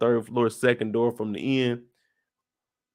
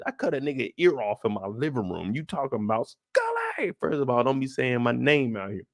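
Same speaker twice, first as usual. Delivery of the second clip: ordinary delivery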